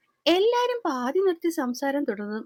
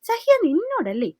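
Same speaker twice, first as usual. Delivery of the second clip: louder, higher burst of impatience; raised angry voice; 6 semitones higher, 5 dB louder than usual. A narrator's reading. Sree losing patience with crying Sahya, voice raised. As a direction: raise your voice to demand an answer. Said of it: "അവന്റെ ശബ്ദം വല്ലാതെ ഉയർന്നും".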